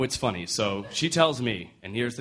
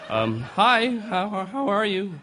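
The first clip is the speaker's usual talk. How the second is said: nervous stammer; 8 semitones higher, slower than usual. Retold or imitated. Nervous suitor on the phone.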